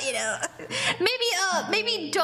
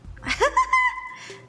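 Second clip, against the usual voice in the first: short high giggle; a quick high laugh. Real, not acted. Host to viewers, laughing at herself with a high giggle.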